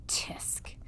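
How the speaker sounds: a soft unvoiced tsk of the tongue; tutting under his breath; whispered tsk. A narrator's reading. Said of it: "said Roscuro".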